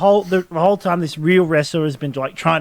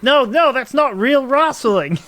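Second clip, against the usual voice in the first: high, loud mock protest, stretching "wrestling" into "wrasslin'"; hammed-up indignant coach; outraged wrestling coach; 9 semitones higher, 4 dB louder, slower than usual. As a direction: wail it as an exasperated purist. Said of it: "And he's like".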